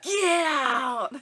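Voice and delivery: high, loud get out through laughter; laughing re-enactment of the alert yelling; mock yell. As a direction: shout it like the alert. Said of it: "it yells".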